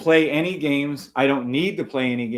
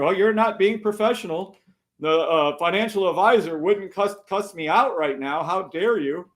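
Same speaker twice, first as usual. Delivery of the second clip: higher, put-on outraged complainer; mock offended critic; 5 semitones higher than usual. Streamer imitating a critic shocked by his cussing, mock outrage. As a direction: parody someone scandalized, pitched up.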